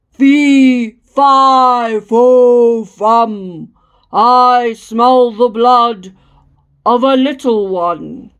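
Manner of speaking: booming, drawn-out giant chant; loud giant voice. A narrator's reading. Giant sniffing out Jack under the table, loud and slow. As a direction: boom it out slowly like a storybook giant. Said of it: "He heard a loud voice saying".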